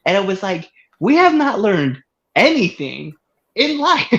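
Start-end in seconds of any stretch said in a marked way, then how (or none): 3.5-4.1 exasperated squeal. Real, not acted. Exasperated rant at lady.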